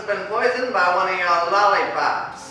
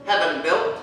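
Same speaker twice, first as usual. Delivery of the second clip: moody hard-boiled narrator voice; noir detective. Acted. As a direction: narrate like an old crime film.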